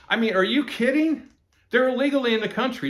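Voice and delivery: high, incredulous outrage; are you kidding me, disbelief. Real, not acted. Outraged at voting rights idea.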